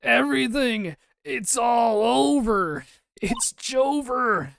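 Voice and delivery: high, drawn-out theatrical wails; hammed-up loud, slow lament; screaming in despair. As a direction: scream as if your life is over. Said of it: "is that your client screaming over there".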